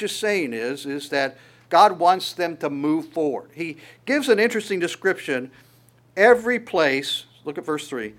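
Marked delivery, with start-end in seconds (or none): 6.2-7.3 "Every place" slow and louder; emphasis. Shown as a demonstration. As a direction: lean hard on "Every place", slowly. Preacher stresses key words.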